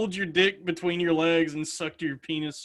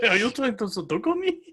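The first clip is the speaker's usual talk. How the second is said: high put-on voice through laughter; giggly mock film character; spoof seduction line; 7 semitones higher, faster than usual. Friend echoing the Sudoku joke, laughing put-on voice.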